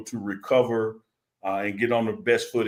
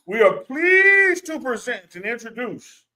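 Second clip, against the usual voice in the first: a grand showman's announcement, climbing high; ringmaster; 13 semitones higher, 7 dB louder than usual. Pretend. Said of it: "let me give her one".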